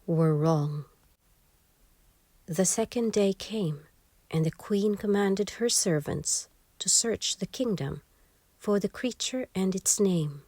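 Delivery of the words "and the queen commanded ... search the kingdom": plain reading voice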